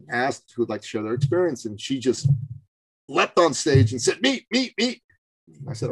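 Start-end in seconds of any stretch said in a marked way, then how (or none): 4.2-5.0 high-pitched eager cries; eager volunteer. Retold or imitated. Woman leaping on stage to share her experience, mock-eager high voice. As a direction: imitate someone jumping at the chance, pitched way up.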